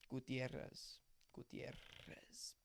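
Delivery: Gutierrez with a weak, soft r roll. Shown as a demonstration. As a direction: Gutierrez trailing into a whispered, faint trill. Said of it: "A very weak roll".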